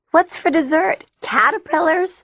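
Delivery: very high, squeaky through laughter; barely intelligible. A narrator's reading. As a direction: choke it out while cracking up. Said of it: "I said, barely intelligibly".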